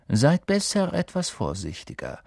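sly, suspicious schoolmaster; menacing teacher. A narrator's reading. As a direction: warn the pupils with veiled threat.

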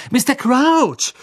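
high, loud, drawn-out cry; calling out. Acted. Harry insisting to Snape who it is, crying out. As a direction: call the name out urgently, stretched. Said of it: "rief Harry".

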